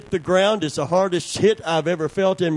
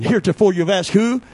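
usual speaking voice

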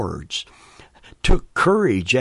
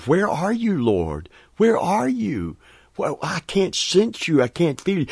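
feeling forsaken; 7 semitones higher than usual. Retold or imitated. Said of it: "I've wondered".

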